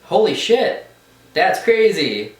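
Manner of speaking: amazed. Retold or imitated. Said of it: "I was like".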